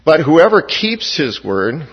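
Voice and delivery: "keeps his word" drawn out slowly and firmly; emphatic. Real, not acted. Preacher underlining a verse for the congregation, emphatic. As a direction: stress "keeps his word", slow and deliberate. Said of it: "which is what I'm emphasizing".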